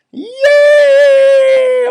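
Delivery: very high, loud squealing cheer; a big happy cheer; animals cheering. Acted. Forest animals cheering for the bears, loud high cheer. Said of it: "All the animals cheered".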